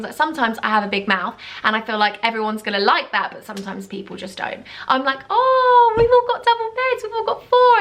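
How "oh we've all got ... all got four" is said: loud, very high mock excitement; hammed-up excited squeal of words; excited boasting. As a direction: gush it, pitched way up. Imitating her own excited boasting.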